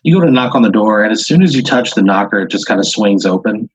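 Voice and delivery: ordinary talk, nothing marked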